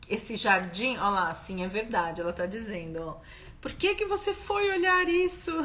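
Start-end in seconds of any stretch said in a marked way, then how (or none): 3.6-5.5 higher, lightly mocking mother voice; imitating worried mother. Daughter mimicking her mother's complaint, higher mock mother voice. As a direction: play the baffled mother, pitched up.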